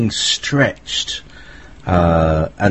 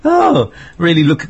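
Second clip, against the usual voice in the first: excited crystal voice; 7 semitones higher than usual. Retold or imitated. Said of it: "very excited".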